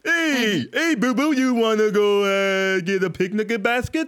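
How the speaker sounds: hammed-up goofy cartoon bear; Yogi Bear. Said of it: "they sound like Yogi Bear".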